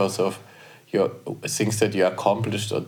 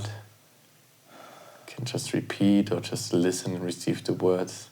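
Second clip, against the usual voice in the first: soft guiding voice; 5 dB quieter, slower than usual. Acted. Guides a self-compassion exercise.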